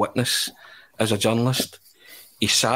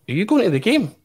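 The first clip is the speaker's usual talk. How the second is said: much higher, eager friendly question; 11 semitones higher, 4 dB louder, faster than usual. Retold or imitated. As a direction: imitate a bubbly star asking. [name] voiced in story.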